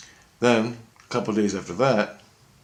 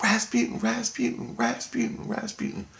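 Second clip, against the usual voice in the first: high eerie calling. Retold or imitated. Voice heard on waking.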